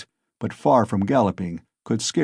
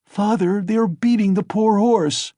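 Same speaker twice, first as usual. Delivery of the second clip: loud, high distressed pleading; horrified child; 10 semitones higher, 3 dB louder than usual. Acted. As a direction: beg the father, horrified. Boy horrified at beating.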